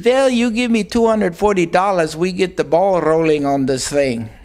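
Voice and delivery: imitated Hungarian accent, starting high and easing down. Travel agency lady asking for money, imitated Hungarian accent. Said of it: "she says to me in her accent".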